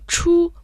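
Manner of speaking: "чу" in the Mandarin first tone, high and level. Shown as a demonstration. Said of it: "произносится в первом тоне".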